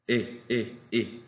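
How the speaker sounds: brief "I" sounds, barely articulated; short vowel I. Shown as a demonstration. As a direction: say "I" short, mouth almost still. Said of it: "Casi no articulo".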